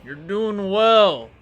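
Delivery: loud, slow encouraging call. Acted. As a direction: call it out across the battlefield. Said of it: "as he calls out".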